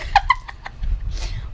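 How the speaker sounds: shrill startled laugh; high burst of laughter. Real, not acted. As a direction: laugh out in surprise. Rock splashes into water.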